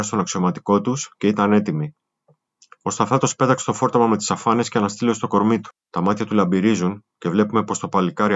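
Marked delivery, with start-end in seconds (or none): none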